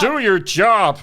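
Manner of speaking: fuller baritone take on a guru; Jordan Peterson. Shown as a demonstration. Answering the squeaky take, firm baritone guru impression. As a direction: order it firmly in a put-on voice. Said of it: "Yours much deeper baritone".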